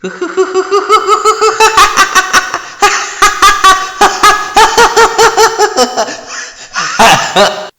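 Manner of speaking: long, loud, high cackle; Joker's maniacal laugh. Acted. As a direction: giggle building into hysterical villain laughter. Joker ends monologue laughing.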